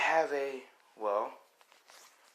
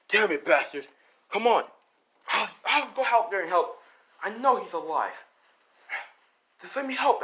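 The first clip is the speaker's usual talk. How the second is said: high, loud acted outburst; angry grandpa; 8 semitones higher, 4 dB louder than usual. Grandpa demanding to help find the boy, angry. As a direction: insist heatedly, pitched well up. Grandpa insists on joining search.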